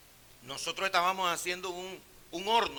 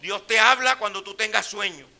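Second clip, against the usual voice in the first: loud, driving preacher's refrain, each line falling; full-force preaching; 5 semitones higher, 10 dB louder than usual. Acted. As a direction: hammer the promise home to the church.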